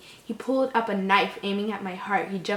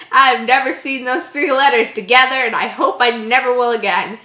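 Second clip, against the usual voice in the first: loud exasperated rant; loud outburst; 10 dB louder than usual. Real, not acted. Reader baffled by the spelling of Lou, loud outburst. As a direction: blurt it out, louder. Loud complaint about the name.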